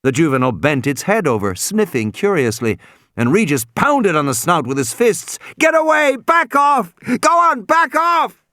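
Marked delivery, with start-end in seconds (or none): 5.6-8.4 high, loud shout to drive off the dinosaur; shouting at the top of his lungs. A narrator's reading. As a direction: yell it with everything you've got. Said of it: "Regis was shouting at the top of his lungs".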